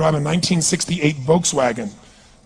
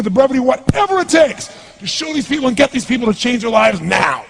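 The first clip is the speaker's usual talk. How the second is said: commanding, building higher to a shout; forceful incantation; 3 semitones higher than usual. Acted. Coach re-enacting his self-command before a meeting, forceful incantation. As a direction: demand it with total intensity. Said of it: "incantation using my whole body".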